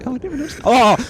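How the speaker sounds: sudden high yelp of fright; startled shriek. Real, not acted. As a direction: jump and cry out. Jump scare.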